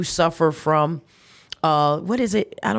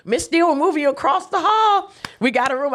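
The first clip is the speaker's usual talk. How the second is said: a lightly raised, higher voice for the hospital staff; 10 semitones higher than usual. Retold or imitated. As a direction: play the staff member bringing good news, pitched up. Staff announcing a room opened.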